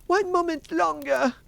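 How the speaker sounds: very high, loud begging; frantic plea. A narrator's reading. Wife stalling the threatening Bluebeard, frantic high plea. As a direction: beg for time at a high pitch.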